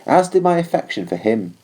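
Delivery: ordinary delivery, nothing marked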